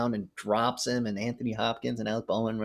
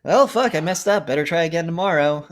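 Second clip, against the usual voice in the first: much higher, louder mock exasperation; Anthony Hopkins; 8 semitones higher, 9 dB louder than usual. Retelling the film, Hopkins shrugs off a failure, loud and high. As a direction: ham up the failed survivor's cheerful frustration. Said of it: "Anthony Hopkins is like".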